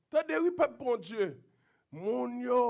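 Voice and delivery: ordinary talk, nothing marked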